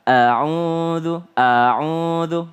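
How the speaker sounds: A'UDZU with a long, unhurried U; full length. Shown as a demonstration. Teacher modelling a'udzu unhurried, full length. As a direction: give each sound of A'UDZU its due. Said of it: "Berikan haknya ya".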